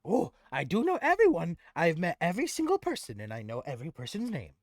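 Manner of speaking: high, loud goblin, easing at the end; eager goblin. Acted. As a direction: pipe up as an eager little goblin.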